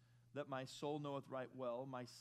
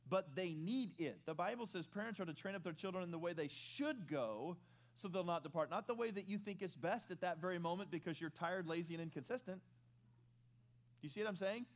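rising preaching; 4 semitones higher, 4 dB louder than usual. Acted. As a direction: drive the point home from the pulpit. Preaching at higher pitch, louder.

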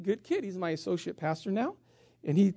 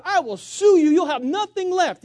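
threatening attorney; 7 semitones higher, 8 dB louder than usual. Retold or imitated. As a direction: lay down the legal threat.

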